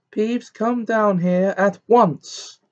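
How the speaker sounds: slow, high, loud command; angry yell. A narrator's reading. Professor ordering the poltergeist down, angry yell. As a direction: order it furiously, stretching the words out. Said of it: "yelled an angry voice".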